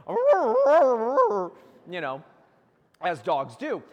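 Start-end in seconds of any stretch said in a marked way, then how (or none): 0.1-1.5 mock dog barks, loud and high; hammed-up dog yapping; dog barking. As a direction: bark like a Lassie dog. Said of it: "he's just like".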